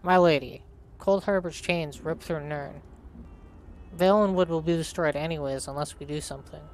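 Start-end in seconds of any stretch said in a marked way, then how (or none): none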